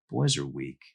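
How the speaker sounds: usual speaking voice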